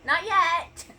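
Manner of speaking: loud, high call across the house. Real, not acted. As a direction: shout it to someone in another room. Calling out to someone offstage.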